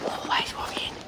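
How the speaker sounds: under-the-breath whisper; whispered aside. Real, not acted. Muttered aside to camera.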